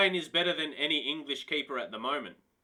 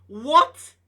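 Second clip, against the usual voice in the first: a sudden loud high shocked "What?"; shocked yell; 6 semitones higher, 11 dB louder than usual. Real, not acted. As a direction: blurt it out in total shock. Shocked by release clause.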